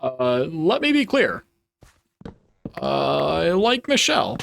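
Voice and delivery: deep as your voice goes, mock-presidential; halting statesman impression with long pauses; Obama. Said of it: "as deep as my voice goes".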